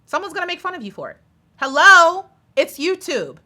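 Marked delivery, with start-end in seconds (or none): 0.1-1.2 higher, softer and fast, tossed off; quick mocking singsong. Shown as a demonstration. Tossed off to fellow YouTubers, quick higher mocking voice. 1.6-2.3 higher, louder Hello?, like knocking on a head; loud incredulous hello. Real, not acted. To critics who can't see it, loud incredulous call.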